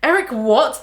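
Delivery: bentakan keras dan tinggi; berteriak tak percaya. A narrator's reading. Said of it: "the Trunchbull shouted".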